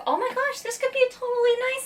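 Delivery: high excited gush; excited squeal. Real, not acted. Unboxing a gift, high excited gush. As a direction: gasp out a happy guess.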